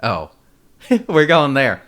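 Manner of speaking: drawn-out "oh" with a laugh; retold startled reaction. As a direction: react as if realizing where it's headed, laughing. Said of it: "I was like".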